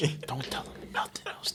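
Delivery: hushed whisper. Real, not acted. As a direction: whisper it like a secret.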